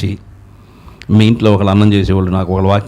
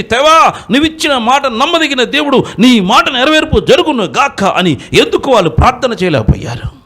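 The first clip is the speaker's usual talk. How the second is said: fiery preaching. Acted. Preacher rebuking the congregation for weak faith, fiery preaching. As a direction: preach it hard and rising to the crowd.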